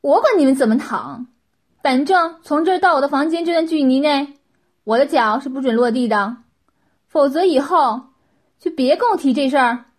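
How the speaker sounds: loud, harsh ultimatum; heartless, merciless. A narrator's reading. Master giving servants orders on the floor, loud and merciless. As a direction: lay down the rule loudly, without pity. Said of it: "秦无情地说".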